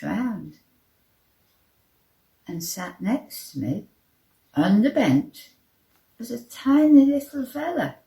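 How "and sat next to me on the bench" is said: usual speaking voice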